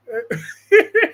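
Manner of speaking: short high burst of laughter. Real, not acted. Laughing at a viewer's comment.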